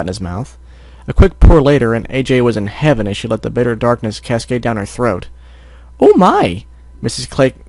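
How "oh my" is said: high, loud shopkeeper exclamation; exclaiming. Acted. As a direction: exclaim in a high-pitched, astonished voice. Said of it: "Missus Cake exclaimed".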